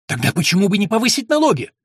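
high, loud, impatient question; heated. A narrator's reading. Young lord pushing his steward to raise taxes, heatedly. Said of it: "начал закипать Уильям".

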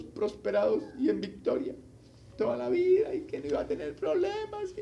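a whiny, half-crying lament of a worried prisoner; despairing prisoner. Pretend. Preacher voicing a jailed convert's fearful lament. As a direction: play the scared prisoner moaning in his cell.

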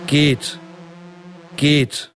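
ordinary talk, nothing marked

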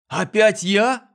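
high, slow indignant outburst; flaring up. Acted. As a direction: snap back like an offended boy. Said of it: "вспылил мальчик".

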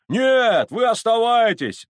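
high, very loud cry; emphatic shouted refusal; shout from crowd. Acted. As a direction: yell it out over a crowd. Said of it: "закричали из толпы".

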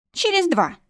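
high-pitched stubborn counter; balking. A narrator's reading. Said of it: "всё-таки заортачилась она".

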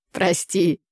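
plain reading voice

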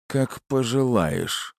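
slow, spiteful hiss; angry hiss. A narrator's reading. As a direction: hiss it through the anger. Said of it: "со злостью прошипел". (злобное шипение)

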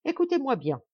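high, commanding and brief; imperious and curt. A narrator's reading. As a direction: order them to listen, no room for doubt. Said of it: "de cette voix impérieuse et brève".

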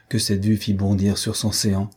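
plain reading voice

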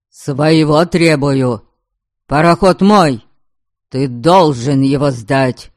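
loud, heated demand. Acted. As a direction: insist hotly on ownership. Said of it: "Горячился Алексей". (горячо)

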